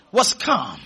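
ordinary talk

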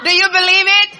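high shouted challenge to the congregation; full-force preaching. Real, not acted. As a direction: rouse the crowd at full voice.

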